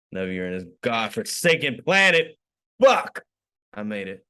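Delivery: normal talking voice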